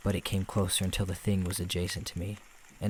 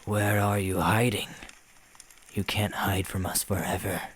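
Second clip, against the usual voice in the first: put-on old smoker's voice. A narrator's reading. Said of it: "an old smoker's voice".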